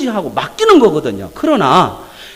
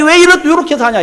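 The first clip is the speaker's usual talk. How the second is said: loud scolding voice of God, booming; 6 semitones higher, 7 dB louder than usual. Acted. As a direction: thunder down on Abraham like an angry father. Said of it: "막 혼내요". (크게 꾸짖는 하나님 목소리)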